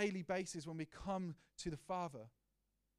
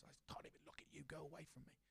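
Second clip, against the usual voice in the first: much softer, unvoiced, cold whisper; 15 dB quieter than usual. Father to his naughty son, cold whisper. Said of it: "I said that this week to one of my boys".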